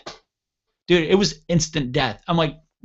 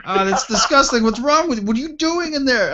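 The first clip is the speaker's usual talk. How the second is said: very high-pitched mock disgust; appalled buddy's high holler, acted out; imitated disgusted outcry; 11 semitones higher, 3 dB louder than usual. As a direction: pitch it way up, appalled, as a retold quote. Disgusted buddy outside the door.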